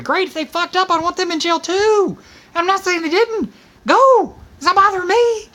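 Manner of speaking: rising to a full exasperated shout; heated retort. Real, not acted. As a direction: shout down the whataboutism. Heated reply to Republican friends.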